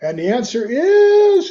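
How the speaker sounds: slow, high drawn-out announcer build; game show reveal. Pretend. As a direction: stretch it like a quiz host.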